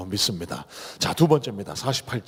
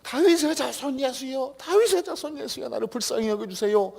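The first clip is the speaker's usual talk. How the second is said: much higher, slowed, desperate repeated plea; crying out ever louder; 10 semitones higher, 3 dB louder than usual. Retold or imitated. Blind beggar crying until Jesus looks at him, desperate cry. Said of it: "더 크게".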